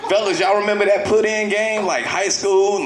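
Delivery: ordinary delivery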